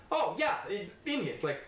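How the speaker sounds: a hint of an older coworker. Coworker voiced in story.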